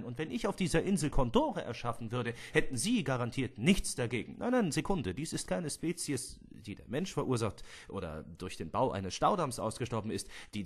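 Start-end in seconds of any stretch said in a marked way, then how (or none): none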